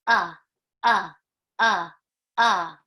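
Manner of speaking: loud clipped short vowel grunts; alien talk. Pretend. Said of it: "it would sound like this".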